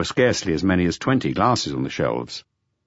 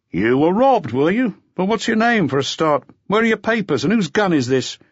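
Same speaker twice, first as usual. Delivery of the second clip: high, louder official questions; questioning policeman; 11 semitones higher, 3 dB louder than usual. Acted. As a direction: question him briskly.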